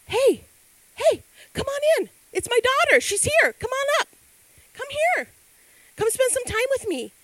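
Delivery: high, loud, delighted calling out; God calling his daughter up to the throne; joyful welcome. Retold or imitated. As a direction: call her in with open-armed joy.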